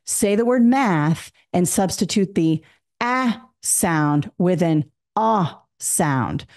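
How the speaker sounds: slow drill, first "ah" as in cat, second "ah" as in father; isolated vowel sounds. Shown as a demonstration. Teacher asks students to substitute, each "ah" isolated slowly. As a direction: set each "ah" apart, front vowel then open back vowel. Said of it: "and I say".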